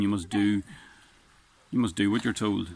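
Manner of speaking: ordinary delivery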